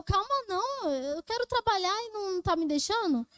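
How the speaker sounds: high-pitched, worked-up protest without real volume; indignant mock yell; retold angry yelling. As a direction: re-enact losing your temper at a coworker. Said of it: "E eu gritando assim".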